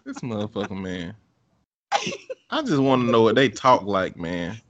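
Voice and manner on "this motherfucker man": muttered admiration. Retold or imitated. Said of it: "I was like".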